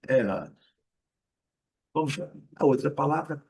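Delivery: usual speaking voice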